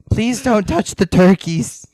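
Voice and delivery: slow pleading. Retold or imitated. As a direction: plead it slowly and loudly. Mock pleading officials.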